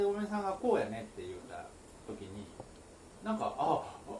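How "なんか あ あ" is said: choking on a drink mid-sentence; sputtering, caught by a choke. Real, not acted. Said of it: "むせたな、今。".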